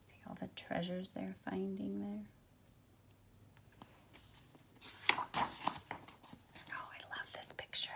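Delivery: whispered aside over the book; hushed whisper. Real, not acted. Teacher whispering to students about the picture, hushed. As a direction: lean in and breathe it to the class.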